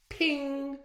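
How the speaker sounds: ordinary delivery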